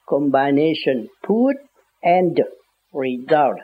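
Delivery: combination put and result, chậm rãi từng chữ; slow and spelled out. Shown as a demonstration. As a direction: spell it out slowly like a teacher. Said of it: "là computer phải không?". (đọc chậm, tách từng chữ)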